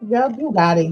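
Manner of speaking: normal talking voice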